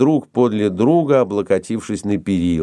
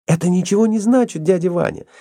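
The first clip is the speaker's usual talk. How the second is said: high-pitched, insistent vow; eager determination; 9 semitones higher than usual. A narrator's reading. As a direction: brush the obstacle aside eagerly, voice up high. Fanny's eager vow to get gold. (с азартом, решительно)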